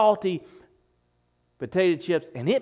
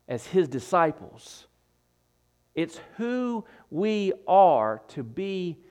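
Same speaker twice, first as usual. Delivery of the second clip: ordinary talk, nothing marked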